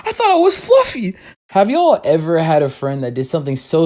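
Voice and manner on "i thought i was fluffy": high, shocked and offended; mock offended. Kid offended when mom calls him fat, high and shocked. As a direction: clutch your pearls.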